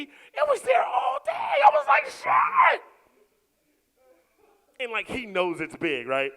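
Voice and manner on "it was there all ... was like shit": high, loud and slow exclaiming; shout. Retold or imitated. Wife and husband marvelling at the son, high loud shout. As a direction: belt it out in shock.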